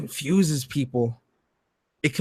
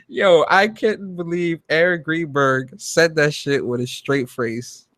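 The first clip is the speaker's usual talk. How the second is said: normal talking voice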